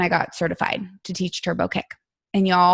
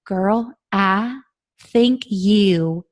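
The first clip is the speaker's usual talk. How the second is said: imitated sweet Southern coach, drawn out slowly; slow, sincere you-can-do-it in a sweet voice; sweet little voice; much slower than usual. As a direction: look them dead in the eye, sweetly encouraging. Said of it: "Just like that, in that sweet little voice".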